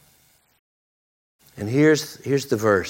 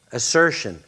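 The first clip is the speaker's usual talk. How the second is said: ordinary talk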